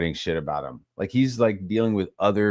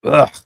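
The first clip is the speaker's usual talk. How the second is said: loud, higher grunt of disgust; disgusted "ugh"; 5 semitones higher, 8 dB louder than usual. Real, not acted. Reacting to grown adults crying, disgusted. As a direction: let out a short revolted groan.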